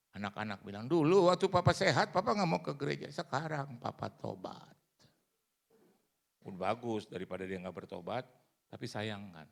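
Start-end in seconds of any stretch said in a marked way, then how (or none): 0.9-4.8 mocking children.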